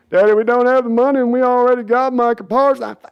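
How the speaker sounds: insist, exasperated with dad; imitating Jerry's son. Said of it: "Jerry and Sons impression".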